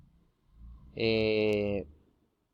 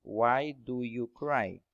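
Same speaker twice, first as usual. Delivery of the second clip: slow, each word of Why do you cry set apart; slow English model sentence; much slower than usual. Shown as a demonstration. Teacher reading the English example to students, slow and clear. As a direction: model it slowly for learners to copy. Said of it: "Pero no significa nada".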